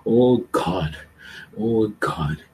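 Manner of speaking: slow, fading prayer murmur. Acted. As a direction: let each phrase sink and soften.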